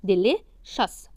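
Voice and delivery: Delicious said very slowly, syllable by syllable, the ci as "sh"; slow drill. Shown as a demonstration. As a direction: stretch out Delicious slowly for the class. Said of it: "vowel rule then drill".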